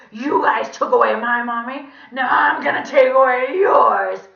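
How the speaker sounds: loud, high creepy donkey voice; vengeful villain squawk. A narrator's reading. Villain threatening the class with revenge, vengeful.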